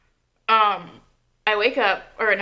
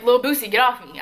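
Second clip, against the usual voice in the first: louder, raised mock shout; retold protest; 3 semitones higher, 6 dB louder than usual. Storyteller telling the ex to let go, retold raised protest. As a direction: call out insistently, as retold. Retold plea while being held down.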